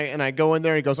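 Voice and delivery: normal talking voice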